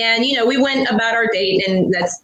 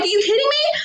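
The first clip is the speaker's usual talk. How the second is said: high disbelief; 12 semitones higher, faster than usual. Retold or imitated. Disbelief in cop car.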